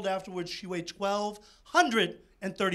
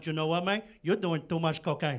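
Tony Montana; 6 semitones lower than usual. Retold or imitated. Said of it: "Tony Montana from Scarface going".

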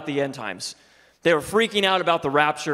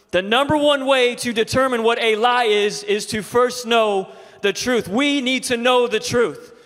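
loud, higher, driving preaching voice; 8 semitones higher, 5 dB louder than usual. Acted. Pastor drives home the truth to his congregation, preaching loud. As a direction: preach it to the whole room.

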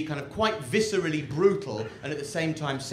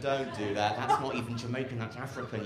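ordinary talk, nothing marked

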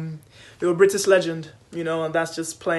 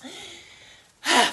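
puffing between words after the rush; out of breath; 5 semitones higher than usual. Real, not acted. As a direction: talk while catching your breath. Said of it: "I'm out of breath".

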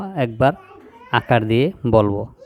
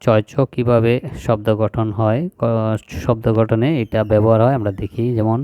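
ordinary delivery

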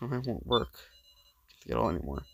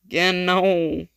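slow, loud, high cry of despair; loud drawn-out despairing cry; anguished wail; 6 semitones higher, 8 dB louder, much slower than usual. Acted. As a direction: drag the words out in anguish. Failing again, despair.

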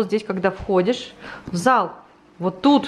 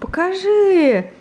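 high, slow, eager ask; excited; 10 semitones higher, 3 dB louder, much slower than usual. Acted. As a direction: play it up for a small child. Playing excited for toddler.

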